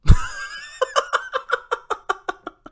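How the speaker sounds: long, high cackle in breathy bursts; high wheezing laugh. Real, not acted. YouTuber laughs at the stock's chart, high wheezing laugh.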